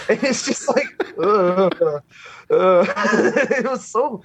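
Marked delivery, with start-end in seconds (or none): 1.2-2.9 low "ugh" of revulsion; drawn-out cringing groan, twice; disgusted groan. Retold or imitated. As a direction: groan as if queasy. Disgust at creepy song lyrics.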